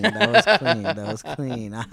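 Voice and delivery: repeating it through laughter; laughing. Real, not acted. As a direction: giggle while saying it.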